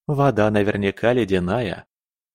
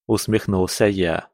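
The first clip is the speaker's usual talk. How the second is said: usual speaking voice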